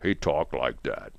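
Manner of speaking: a low, deep country-singer voice, lightly done. Retold or imitated. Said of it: "a [name] voice".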